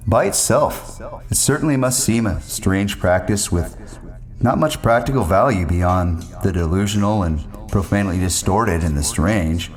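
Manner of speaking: usual speaking voice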